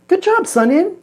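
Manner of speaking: high, bright little cheer; excited cheer. Real, not acted. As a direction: cheer the product on. Cheering the product after step one.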